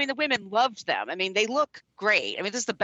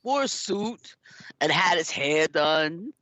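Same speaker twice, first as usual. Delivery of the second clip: mock Stallone; 2 semitones lower than usual. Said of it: "Sylvester Stallone was talking about".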